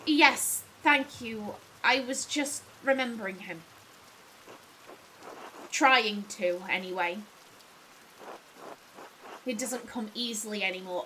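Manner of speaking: lightly voiced weary master, trailing low; subdued, sinking at the ends; weary Andreas. Master admitting his memory fails to his assistant, subdued.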